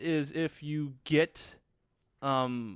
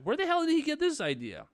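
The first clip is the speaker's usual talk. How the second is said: high-pitched incredulous question; baffled disbelief; 12 semitones higher than usual. Acted. Acting out the baffled audience.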